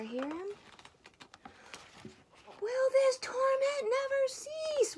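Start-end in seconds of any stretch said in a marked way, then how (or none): 2.6-5.0 very high, loud, drawn-out wail. A narrator's reading. Bear at the end of his patience with Mouse, wailing. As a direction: wail slowly in theatrical torment. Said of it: "wailed the bear".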